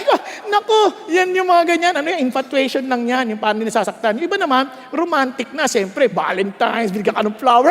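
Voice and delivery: ordinary delivery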